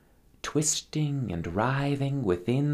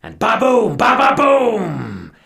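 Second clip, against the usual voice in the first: thunder boom; 11 semitones higher, 12 dB louder, slower than usual. Acted. Thunderclap in the story.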